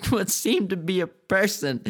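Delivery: real giggles creeping into the reading; breaking up laughing. Real, not acted. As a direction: lose it mid-sentence, then laugh.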